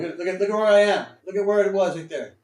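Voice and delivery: blurting it out in disbelief at the screen; a real burst of trading frustration, quick and loud; exasperated outburst. Real, not acted. Trader exclaims over losing stock.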